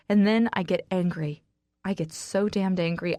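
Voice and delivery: ordinary delivery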